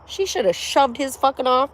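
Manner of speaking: ordinary talk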